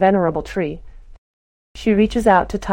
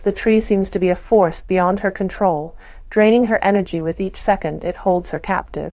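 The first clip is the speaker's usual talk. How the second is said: usual speaking voice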